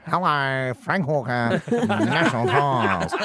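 nasal Aussie salesman voice; Frank Walker impression. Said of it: "I'm a big fan of".

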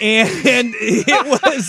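words broken by laughter; laughing. Real, not acted. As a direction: laugh through the line. Laughing while telling story.

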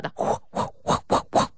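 breathy unvoiced bird flutter; chattering magpie voice. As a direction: rasp out a swooping wing noise. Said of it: "You're really good at bird noises".

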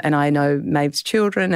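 normal talking voice

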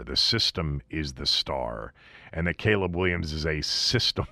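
ordinary talk, nothing marked

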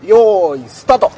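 loud high start shout; starting call. Real, not acted. (大声でスタートの合図)

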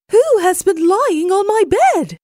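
high, loud indignant cry; Mama Bear. A narrator's reading. Mother bear sees her rumpled blanket, high outcry. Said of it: "cried out".

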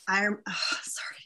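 breaking into a whisper, overcome; words catching as tears come; choked up. Real, not acted. Telling the interviewer about her first worship, choked up. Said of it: "I don't know why I'm getting emotional".

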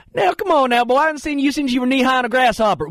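hammed-up Southern hollering, high and fast; Larry the Cable Guy. Host mocking how drinking games turn his voice, exaggerated Southern accent. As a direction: crank the Southern twang way up and rattle it off. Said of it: "you are now talking like you are Larry the Cable Guy".

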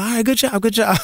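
high, breezy brush-off; a casual judge brushing him off; lightly mocking casting voice; dismissive casting panel. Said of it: "They're like".

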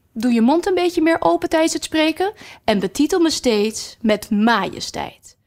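high, clipped announcement; strict queen. Acted. Red queen tells Alice her time starts now, high and strict. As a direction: start the clock like a stern queen.

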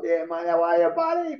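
high, eager hello to a stranger; retold chummy greeting. Freed man talking to people on the bus, overexcited greeting. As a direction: greet everyone like an old mate.

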